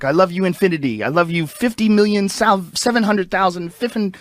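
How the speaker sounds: put-on high, eager kid voice tumbling over big numbers; imitating a child entity's endless love; childlike voice. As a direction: gush like a little kid losing count. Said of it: "something like a kid would say".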